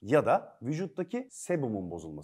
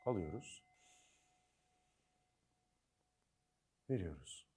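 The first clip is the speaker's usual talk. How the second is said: soft spa whisper; 5 semitones lower, 9 dB quieter than usual. Acted. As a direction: lead a calm breathing exercise softly. Mock meditation opening. (yumuşak, sakin meditasyon sesi)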